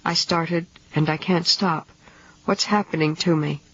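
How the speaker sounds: usual speaking voice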